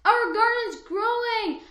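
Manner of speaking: loud, high happy shout; child shouting again that the garden is growing; excited yell. Acted. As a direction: call it out again with joy. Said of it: "I yelled".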